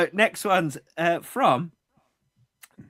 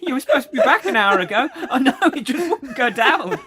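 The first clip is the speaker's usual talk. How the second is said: high, loud bickering through laughter; laughing mock row; 9 semitones higher, 5 dB louder, faster than usual. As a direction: act out a couple's squabble, cracking up. Joining the acted marital row.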